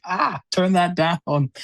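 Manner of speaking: mock complainer. Guest imitating someone at a draft objecting to music, mock complaint. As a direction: imitate someone griping, slow and high. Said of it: "someone was like".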